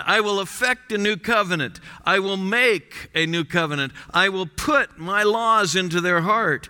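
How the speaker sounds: rising preacher's declaration; preaching recap. Acted. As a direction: proclaim the promises to the congregation. Closing recap of promises.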